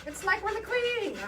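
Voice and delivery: high, hammed-up royal British accent; queenly British accent. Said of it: "that's the accent I'm giving it".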